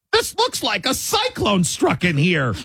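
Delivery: high, slow, put-on mother voice; imagined mom voice. Host imagining a mother entering the messy room, high put-on voice. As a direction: stretch it out high as a caricatured mom. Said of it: "I don't know if it was in that voice".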